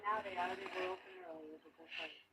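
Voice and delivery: ordinary talk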